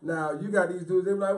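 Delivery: usual speaking voice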